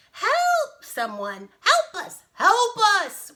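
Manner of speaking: very high, loud cries for help; shrieked, hammed-up animal panic; panicked wailing. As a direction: wail for rescue. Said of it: "wailed the animals".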